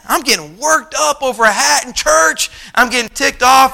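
high, heated preacher's rant; worked up. Retold or imitated. Reliving rising anger over the hat.

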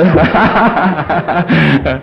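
rolling laugh at his own quip; real laughter. Real, not acted. Preacher jokes about putting someone out, real laughter. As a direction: crack up after the aside.

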